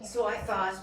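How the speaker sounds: ordinary delivery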